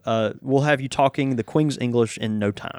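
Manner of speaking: usual speaking voice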